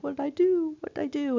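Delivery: high, bewildered what did I do; a student nurse stung by the patient's rejection, asking high and bewildered; hurt confusion. Retold or imitated. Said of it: "I was kinda like".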